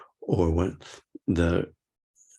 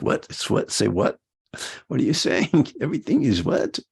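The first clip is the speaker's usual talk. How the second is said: rising, much higher confused "What? Say what?"; mock bewilderment, climbing higher each question; baffled listener; 7 semitones higher, 3 dB louder than usual. Retreat listener puzzled by the French teacher, mock baffled. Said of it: "You'd be like".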